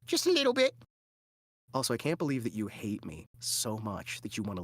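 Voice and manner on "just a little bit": very high, loud mock British urchin; begging child. Little children asking for money, high mock British pleading. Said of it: "little children asking for money".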